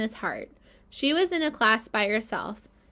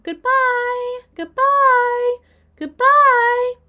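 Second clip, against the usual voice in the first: high, louder goodbye calls, rising each time; baby spiders calling out as they float away; tiny spider voices; 11 dB louder, much slower than usual. A narrator's reading. Baby spiders calling goodbye to Wilbur from far off, high and loud. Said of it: "came weakly to Wilbur's ears".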